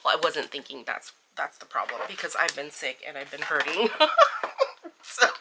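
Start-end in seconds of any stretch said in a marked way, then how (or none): 3.9-5.0 short high squeal of laughter; embarrassed giggle. Real, not acted. Laughing about being sick.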